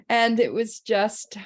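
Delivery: ordinary talk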